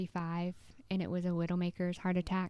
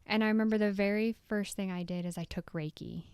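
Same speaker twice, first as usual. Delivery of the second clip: ordinary talk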